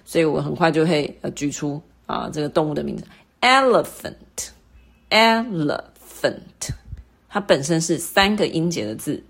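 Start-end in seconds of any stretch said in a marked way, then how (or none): 3.4-6.7 elephant, very slow, the e sung out higher; stress on first syllable. Shown as a demonstration. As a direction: stretch elephant slowly, peak on the e. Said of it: "它的重音在哪里呢". (慢慢念elephant，重音在e上)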